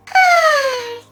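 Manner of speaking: loud, high, whiny sigh; exasperated sigh. Real, not acted. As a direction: let out a frustrated, half-crying sigh. Frustrated after unfair pay offer.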